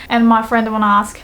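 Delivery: ordinary talk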